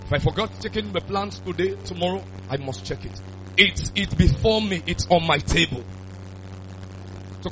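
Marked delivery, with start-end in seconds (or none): none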